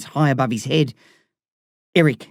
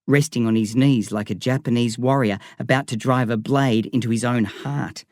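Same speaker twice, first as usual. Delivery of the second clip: ordinary delivery, nothing marked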